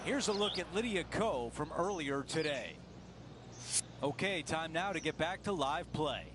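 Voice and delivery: normal talking voice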